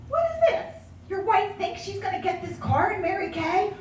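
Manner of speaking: put-on high, taunting young men's voices; a mock sneer at the wife's car dream; mocking friends. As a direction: ham up the friends jeering at her. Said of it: "they'd go".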